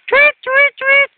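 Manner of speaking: very high squeaking "cuit, cuit" like a crying dolphin; squeaky dolphin cry. Pretend. Voicing the stranded dolphin's cry, very high squeak. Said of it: "dia akan menangis".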